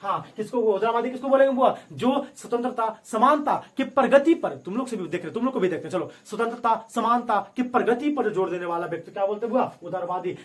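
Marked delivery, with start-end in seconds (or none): 1.9-4.6 slow, deliberate dictation pace; slow emphatic. Shown as a demonstration. Teacher repeating definition to class, slow and emphatic. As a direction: spell it out slowly so they can write. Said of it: "repeats definition slowly for students".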